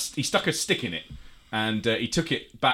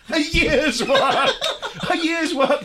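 laughing disbelief; 14 semitones higher, 4 dB louder, slower than usual. Real, not acted. Mocking the year-long butter experiment.

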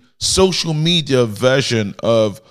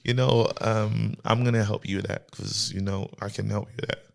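low, quiet, hesitant reply; reluctant acceptance; 4 semitones lower, 9 dB quieter than usual. Acted. Woman agreeing to help someone out, quietly reluctant. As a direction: say it softly and low, trailing away. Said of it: "she's like".

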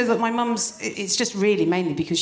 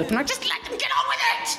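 high, fast exasperated squeal; mock exasperation; faster than usual. Mother fed up with grandma fussing over boys, mock exasperated. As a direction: rush it out, pitched right up.

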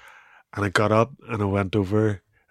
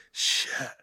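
breathy whispered shit; whispered curse; 8 dB quieter than usual. Retold or imitated. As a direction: let it slip out under your breath. Realising hip dislocated.

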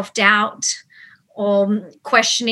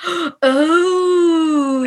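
long drawn-out, higher "oh"; dawning realisation; 6 semitones higher than usual. Retold or imitated. Actor recalling spotting the accent note, drawn-out realisation. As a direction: let a retold realisation swell into a long "oh".

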